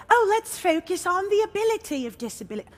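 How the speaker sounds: playful put-on British accent; mock British accent. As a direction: slip into a British accent for the quote. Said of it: "I don't know why that became British".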